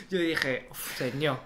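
normal talking voice

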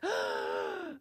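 sharp inhale before a sneeze; sneeze gasp. Acted. Sneeze build-up.

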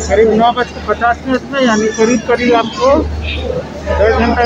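muffled by face covering; speaking behind fabric mask; through a cloth mask. Real, not acted. Seen 2: Grey cloth mask covers mouth and nose throughout; absent in references.

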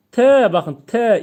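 slow loud T against the teeth; drawn-out demo of T; letter T demo. Shown as a demonstration. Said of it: "Ama T'de ne yapıyoruz?".

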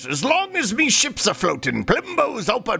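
hammed-up sea merchant pitch; pirate shopkeeper voice.

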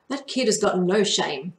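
usual speaking voice

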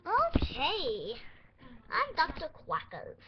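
lightly voiced pretend doctor; put-on doctor voice. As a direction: introduce yourself as a goofy doctor. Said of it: "not my accent".

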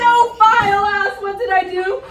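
loud, high, crying out in disgust; horrified wail. Acted. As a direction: wail it like a man who just kissed something foul. Absalom discovers the beard, read dramatically.

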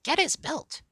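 high, urgent hen voice; eager. A narrator's reading. Hen urges the girl on, eager. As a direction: urge it on, pitched right up. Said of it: "The hen whispered eagerly to the girl".

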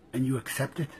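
normal talking voice

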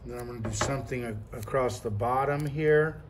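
ordinary talk

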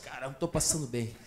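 soft, low, queasy mumble; a sick man fading out; weak sick voice. Acted. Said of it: "ele tá assim".

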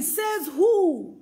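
defiant challenge. Acted. Preacher challenging the doubter, defiant. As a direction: call the doubt out.

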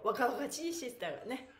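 ordinary delivery

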